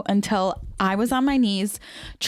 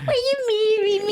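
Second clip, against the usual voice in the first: much higher, squeaky goofy voice; mock proposal voice; 10 semitones higher than usual. Teasing her fiancé's proposal in a squeaky voice. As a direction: mimic his squeaky proposal with a shrug. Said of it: "You even did a shrug".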